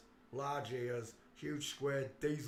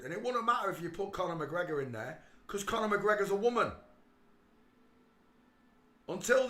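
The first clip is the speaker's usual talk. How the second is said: ranting; 7 semitones higher, 8 dB louder than usual. Real, not acted. Ranting at Ireland.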